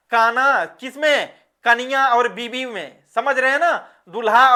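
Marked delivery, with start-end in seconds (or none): none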